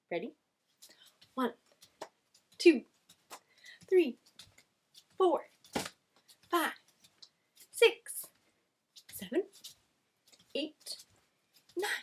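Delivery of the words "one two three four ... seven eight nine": counting out loud. Acted. Counting jumping jacks with kids.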